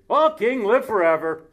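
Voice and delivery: loud, high hail to the king; a booming hail, half in jest; loud royal greeting. Acted. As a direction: call out grandly to the king. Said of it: "then he said".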